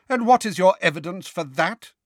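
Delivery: stern, sharp interruption. A narrator's reading. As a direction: cut in sternly. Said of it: "cut in Professor McGonagall".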